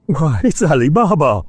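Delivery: high, excited cry of joyful recognition; ecstatic exclaim. Acted. Old Scrooge recognising his boyhood hero, ecstatic. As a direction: burst out with delight at a childhood memory. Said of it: "Scrooge exclaimed in ecstasy".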